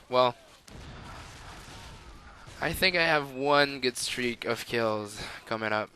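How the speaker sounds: ordinary delivery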